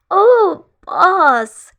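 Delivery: put-on high, loud, stretched-out drunk hail; drunken greeting. Drunk Wing hails his boss, drawn out and loud. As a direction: drag out the call like a tipsy friend.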